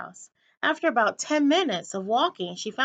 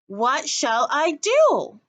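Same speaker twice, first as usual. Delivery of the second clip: louder, higher, slow pleading whine; insistent whine; 6 semitones higher, 5 dB louder, slower than usual. Acted. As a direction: push it again, more insistent. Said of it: "repeated Coraline".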